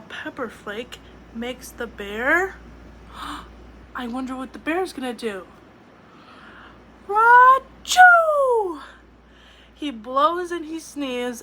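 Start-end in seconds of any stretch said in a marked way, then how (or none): 2.6-3.9 hushed gasp. Acted. Suspense before sneeze. 7.1-8.8 high, very loud explosive sneeze; the sleeping bear sneezes hugely. Acted.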